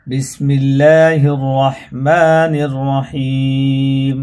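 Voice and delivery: slow, drawn-out tajweed chant; Quran recitation. Shown as a demonstration. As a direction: recite the sura title slowly, melodically. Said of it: "বানান করে।".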